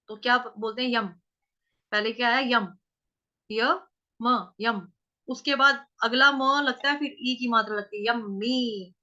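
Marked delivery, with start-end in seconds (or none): none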